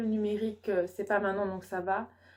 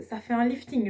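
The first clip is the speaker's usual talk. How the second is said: en riant; 3 semitones higher, 7 dB louder than usual. Real, not acted. Plaisante sur son visage.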